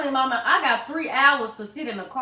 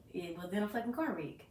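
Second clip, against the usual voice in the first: usual speaking voice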